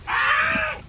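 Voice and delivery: sharp high yelp of pain. Real, not acted. Real yelp as the cigar burns him. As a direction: yelp as if burned.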